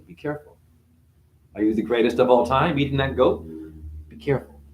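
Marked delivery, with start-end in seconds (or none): 0.0-0.6 hushed warning. Acted. 4.1-4.6 an eerie whispered caution; whispered warning. Acted. Warning the goat eater, whispered.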